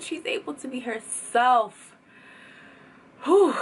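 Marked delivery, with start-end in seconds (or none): 3.2-3.5 excited whew. Real, not acted. Overwhelmed reaction exhale.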